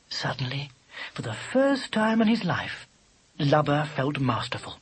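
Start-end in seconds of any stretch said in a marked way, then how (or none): none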